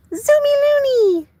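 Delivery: squealed high and slow at the dog; high excited cheer. Real, not acted. Owner cheering her dog's zooms, excited high squeal.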